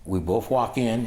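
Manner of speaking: usual speaking voice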